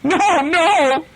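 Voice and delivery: very high terrified wail; crying out. A narrator's reading. Seco cowering before his captain, high crying plea.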